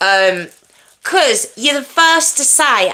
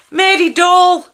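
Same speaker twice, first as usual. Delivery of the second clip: put-on Scottish character accent; 8 semitones higher, 3 dB louder, slower than usual. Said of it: "when he'd be at Mary".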